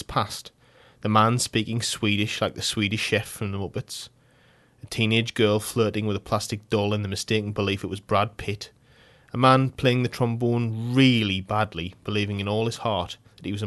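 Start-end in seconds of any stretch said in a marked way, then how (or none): none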